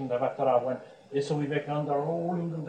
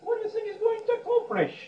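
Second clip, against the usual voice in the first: very high-pitched, incredulous voice; high mock-amazed question; amused retort; 3 dB louder, faster than usual. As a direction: squeal it like an amused guru. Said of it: "Sri Ramakrishna laughed and said".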